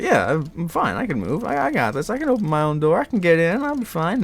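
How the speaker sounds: high, drugged, carefree; loopy. Retold or imitated. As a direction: sound giddy and drugged out. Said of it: "hear about me being loopy".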